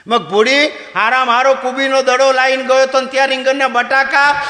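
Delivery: high, loud scolding; husband yells at wife about the same dish; furious husband. Retold or imitated. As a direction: shout at her in disbelief.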